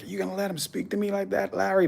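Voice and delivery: high-pitched mock scream at reduced volume; retold screaming. Retelling an American screaming at Larry, high quiet mock yell. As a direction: imitate her outraged screech, high but held back. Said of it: "she was screaming, like".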